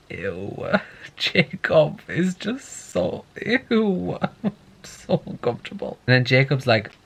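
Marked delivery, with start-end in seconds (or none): 0.1-4.2 slow, drawn-out, grossed-out "ew"; disgusted ew. Real, not acted. Disgust at imprinting scene. 4.8-6.0 hushed, grossed-out whisper. Real, not acted. Whispered reaction.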